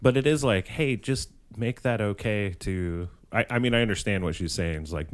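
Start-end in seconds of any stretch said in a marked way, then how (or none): none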